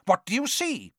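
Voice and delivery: high, impatient question. A narrator's reading. Quirrell demanding what Harry sees, impatient. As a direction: press him, pitch jumping up. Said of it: "said Quirrell impatiently".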